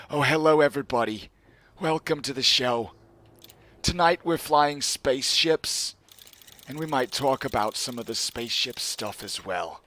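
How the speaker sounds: a tinkling starry showman greeting; Star Talk voice. Acted. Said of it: "my Star Talk voice".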